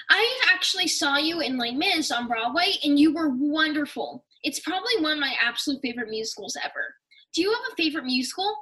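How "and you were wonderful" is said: ordinary delivery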